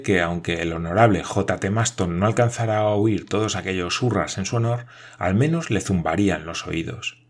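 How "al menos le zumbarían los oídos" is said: ordinary delivery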